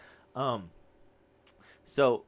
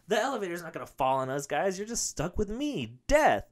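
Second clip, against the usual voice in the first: forced cheerful reassurance; 8 semitones higher, 4 dB louder than usual. Retold or imitated. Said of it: "I'm trying to have, like, a happy face".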